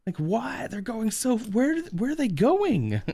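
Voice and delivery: high-pitched, incredulous questioning; mock bewilderment. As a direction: play the baffled visitor, voice way up high. Retold visitor baffled by speeding cars.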